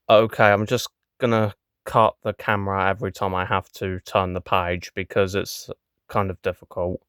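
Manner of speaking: normal talking voice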